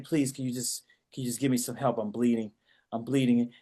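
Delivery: low, soft, faint begging of a bleeding man; weak pleading. Retold or imitated. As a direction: plead softly like you're badly hurt. Dying man begging the cop.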